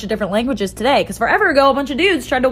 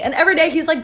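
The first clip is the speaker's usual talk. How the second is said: ordinary talk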